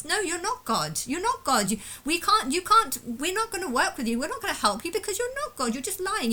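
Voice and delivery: high, mocking imitation of doubters; mocking dismissive voice. Mimicking people who told him he is not God, high mocking. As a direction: parody the people who called him a liar.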